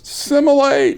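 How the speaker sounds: high, ringing simulate; urgent shout. Retold or imitated. Chief stops him pulling the switch, a high call. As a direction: call it out sharply to stop him. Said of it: "then he goes".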